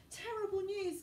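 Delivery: high, breathless gasp of alarm; a schoolgirl blurting terrible news; Hermione gasping. Acted. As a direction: gasp it out like bad news just arrived. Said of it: "gasped Hermione as she ran".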